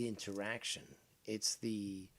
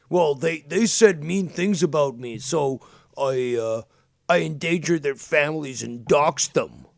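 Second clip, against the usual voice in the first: loud sarcastic mimic of his excuse; mocking imitation; 9 dB louder than usual. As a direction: sneer out the rival's lame excuse at full volume. Mocking rival's excuse, still shouting.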